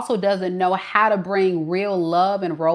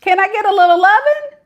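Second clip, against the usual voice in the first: high, loud, fast begging; pleading boyfriend; 12 semitones higher, 7 dB louder, faster than usual. Retold or imitated. Reader voicing the boyfriend begging Shay, high and loud. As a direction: beg for a little love.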